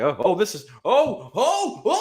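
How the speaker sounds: high panicky oh, oh; panicked yelps. Retold or imitated. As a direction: squeal it like losing control of the mower. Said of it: "He's like".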